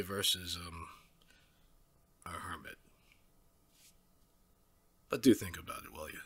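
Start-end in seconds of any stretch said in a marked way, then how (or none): none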